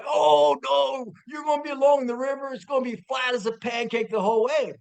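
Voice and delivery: high, fast mock shout; dismissive dad. Friend imitating dad insisting the route is flat, loud and dismissive. Dad's second objection acted out.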